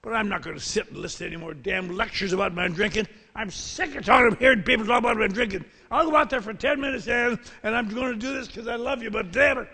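loud, high, fast and fed up; angry protest. Retold or imitated. Drunk doctor to his wife, loud angry protest.

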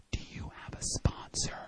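hushed, breathy question in a meeting; soft secret whisper during the meeting. Retold or imitated. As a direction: whisper so nobody else hears. Woman leaning over during meeting.

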